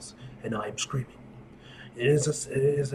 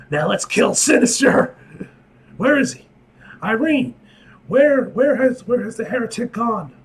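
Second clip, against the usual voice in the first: furious shouting; 9 semitones higher, 10 dB louder than usual. Acted. Character hunting Sinister.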